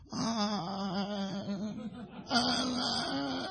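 long, drawn-out clueless "uh"; hammed-up blank hesitation; stumped stalling. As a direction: play a husband desperately searching for an answer. Husband stumped by wife's question.

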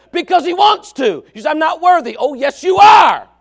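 loud, high, fiery preaching that climbs to shouts; preaching at full force. Acted. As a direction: preach it hard, pushing every line out to the congregation.

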